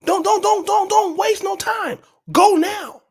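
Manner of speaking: high-pitched, insistent pleading; mock-urgent exhortation. Telling entitled subscribers to leave tonight, high mock-urgent exhortation.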